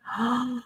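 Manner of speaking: soft, high gasp; quick intake of breath in reaction. Real, not acted. Reader reacting to Anne-Marie's name, soft gasp.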